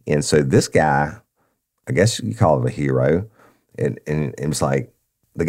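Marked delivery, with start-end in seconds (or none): none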